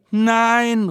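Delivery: high, loud shouted no. Acted. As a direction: shout the word back. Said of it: "rufen wir". (lautes, hohes Nein)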